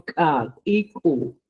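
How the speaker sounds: kakiku slowly, syllable by syllable; slow final model of kakiku; slow final drill. Shown as a demonstration. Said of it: "Y por último".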